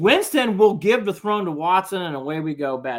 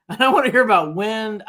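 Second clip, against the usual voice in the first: laughing and louder, a bit higher; 4 semitones higher, 4 dB louder than usual. Real, not acted. Host scoffing at the wind excuse on air, laughing. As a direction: laugh it off in disbelief. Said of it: "Oh, I don't wanna hear about wind".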